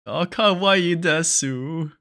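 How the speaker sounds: high, slow, loud fake anime Japanese; Weebanese. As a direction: ham it up like an anime fan.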